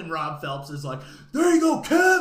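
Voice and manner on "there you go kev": high, loud cheer; loud hearty congratulation shout. Retold or imitated. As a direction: yell it out like a proud dad. Rob cheering the proposal.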